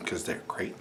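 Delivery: whispered sarcastic aside. Real, not acted. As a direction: mutter it under your breath.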